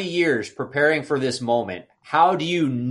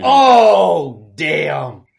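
impressed surprise; 4 semitones higher, 11 dB louder than usual. Retold or imitated. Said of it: "And I'm like".

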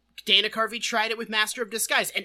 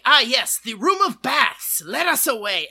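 loud, hammed-up odd foreigner; mock Pistachio voice; 2 semitones higher, 8 dB louder than usual. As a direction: declaim it like a bewildered, pompous traveler. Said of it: "And he's like".

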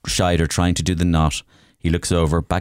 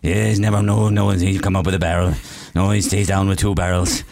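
lightly voiced fishermen. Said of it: "then they go".

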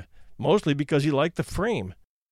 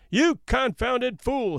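high, slow, bellowing "You confounded fool!"; roaring; 8 semitones higher, much slower than usual. Acted. Steamer officer roaring at a man in the fog, high and slow. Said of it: "he roared".

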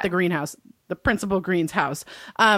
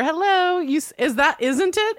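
mock taunting; 8 semitones higher than usual. Mocking the superintendent.

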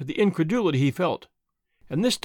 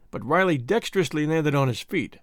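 ordinary delivery, nothing marked

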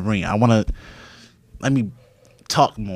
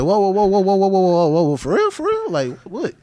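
shocked disbelief; 9 semitones higher than usual. Retold or imitated. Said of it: "I was like".

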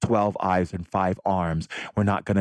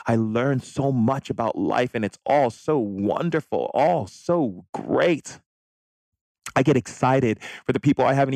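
excited; 5 semitones higher than usual. Real, not acted. Gushing about travel.

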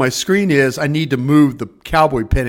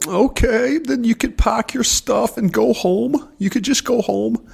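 higher, slow dismissive boss; lightly voiced curt old boss; mock boss voice; 7 semitones higher than usual. Boss sending him home, lightly voiced.

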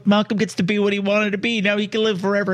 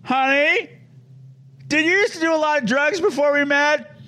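higher-pitched mock husband voice; husband's suspicious question; 9 semitones higher than usual. Podcaster voicing the dad asking his wife, mock-suspicious, higher. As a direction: quote the dad, pitched up and half joking. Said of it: "and he goes".

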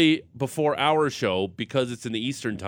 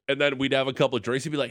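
usual speaking voice

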